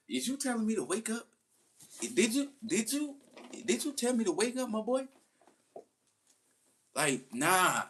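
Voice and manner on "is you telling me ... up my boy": high, incredulous questioning; indignant at being told to wake up; indignant disbelief. Retold or imitated. Grown inmate told to wake up by staff, high incredulous mock outrage.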